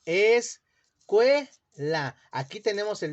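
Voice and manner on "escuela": "Escuela" split very slowly as es-cue-la; "Escuela" broken very slowly into its syllables, es-cue-la; slow syllabified. Shown as a demonstration. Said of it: "¿Cómo dividimos la palabra escuela?".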